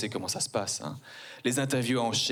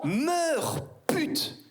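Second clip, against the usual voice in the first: high, then a voiceless pute; literal shout-out. Shown as a demonstration. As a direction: meurs pitched way up, pute breathed out. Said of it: "Donc littéralement".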